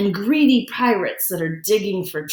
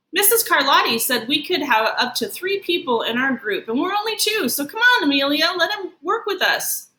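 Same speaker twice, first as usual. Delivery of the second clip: put-on higher, rising kid voice coaxing his friend; pleading boy; 7 semitones higher than usual. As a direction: talk Emilio round, pitch climbing. Justin pleads for Brian.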